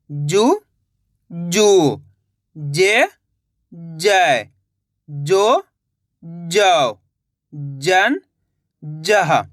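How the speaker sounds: each syllable said alone, slow and clear, with a pause after it; syllable drill. Shown as a demonstration. Teacher drilling students on the barakhadi, clear syllable recitation. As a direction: recite syllables for the class to repeat. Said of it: "जिस तरीके से मैं एक एक अक्षर का उच्चारण कर रहा हूँ".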